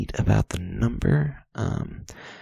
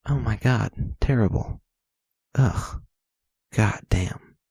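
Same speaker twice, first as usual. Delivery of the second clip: exasperated groaning; 3 dB louder than usual. Real, not acted. Disgusted reaction.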